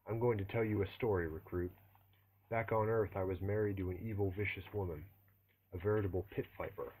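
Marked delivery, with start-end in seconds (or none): none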